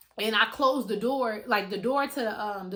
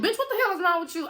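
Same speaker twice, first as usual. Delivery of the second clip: much higher, mock-shocked roommate voice; imitating roommate; 8 semitones higher, faster than usual. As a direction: play the roommate yelling at her. Voicing roommate's reaction.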